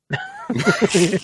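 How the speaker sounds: giggling high and breathless; can't hold the laugh back. Real, not acted. Recalling Cam's praise on stream, high giggle.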